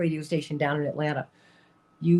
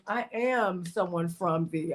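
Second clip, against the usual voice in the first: ordinary talk, nothing marked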